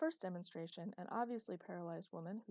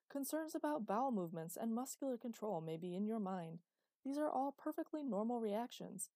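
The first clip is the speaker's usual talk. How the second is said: higher, louder instructional video voice; an eighties training-tape narrator, bright and overeager; hammed-up announcer reading an old rehab video; cheesy video narrator; 3 semitones higher, 4 dB louder than usual. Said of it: "said the narrator".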